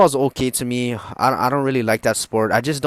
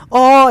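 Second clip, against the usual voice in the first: a high, louder fake pained "oh"; mock groan; 11 semitones higher, 7 dB louder than usual. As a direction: ham up the injured player's moan. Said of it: "He's like".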